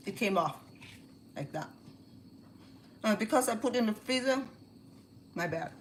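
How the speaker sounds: talking through a mouthful of food; mouth full. Real, not acted. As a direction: speak around a bite of coconut. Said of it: "talking with mouth full".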